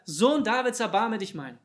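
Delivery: loud, high beggar's cry; calling out. Acted. Beggars call after Jesus as he walks on, loud insistent cry. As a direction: shout the plea to someone walking past. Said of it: "riefen sie wieder".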